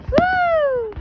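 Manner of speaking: high, loud "woo"; high cheering whoop; excited whoop. Real, not acted. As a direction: whoop it out in celebration. Cheering at fireworks show.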